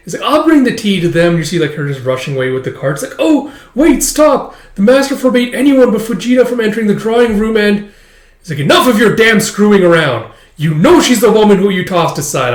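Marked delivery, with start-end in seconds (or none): 0.2-1.4 high, loud volunteering; eager little girl. Acted. Child eagerly grabs the cart. 3.2-7.9 high, loud calling after someone; urgent squeal to stop; panicked maid. Acted. Panicked maid stops running child. 8.6-12.5 loud, raised accusing voice; angry outburst. Acted. Angry quarrel overheard in drawing room.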